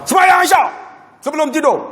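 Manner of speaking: high firm command to pray; father ordering his son to pray before sleep; father's order. Retold or imitated. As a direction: tell the boy to pray before bed. Father ordering evening prayer.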